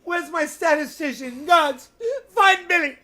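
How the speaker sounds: frantic high shouting for the statistician, laughing; hammed-up panicked calling out; high, loud comic panic through laughter; mock panic. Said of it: "I'm panicking".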